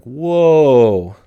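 loud, high, drawn-out whoa; mock shock, pulling on the brakes; acted alarm. Acting out sudden alarm at aging.

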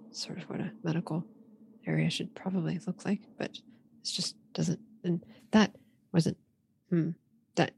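soft, broken mumble to oneself; muttering. Acted. Liko doubting the tidied medical area, muttering. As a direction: trail along under the breath, unsure. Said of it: "stop muttering".

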